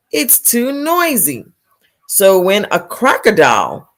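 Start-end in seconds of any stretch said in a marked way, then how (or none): 0.1-1.5 high, whiny little ladybug. A narrator's reading. Teacher voicing the ladybug complaining to the tiger. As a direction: squeak it like a tiny tired bug. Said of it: "said the lazy ladybug".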